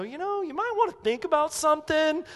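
high, mock-tactful hinting; passive-aggressive. Preacher imitating a backdoor confrontation, passive-aggressive. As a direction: sidle up to the criticism in a sing-song. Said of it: "'cause we're passive-aggressive in our approach".

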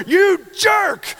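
high, loud mock road-rage yell; angry yell. Driver cut off on the way to work, hammed-up high loud yell.

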